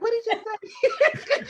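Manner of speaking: high, loud, slow and laughing; baffled listener. Retold or imitated. Host mocking her own confusion at Krilios's fast speech, laughing and baffled. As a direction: stretch it out like you can't follow the fast talker. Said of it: "he would speak so fast".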